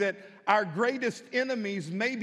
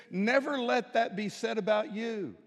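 usual speaking voice

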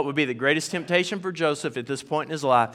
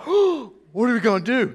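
slow, higher, mock-panicked plea; panicked coach; 6 semitones higher than usual. Coach in mock panic over the wrestler. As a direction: play the coach losing his cool. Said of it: "So coach is like".

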